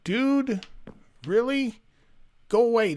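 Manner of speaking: exasperated at a game mob; exasperated shooing. Real, not acted. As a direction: shoo off a pest in disbelief. Annoyed at Enderman in game.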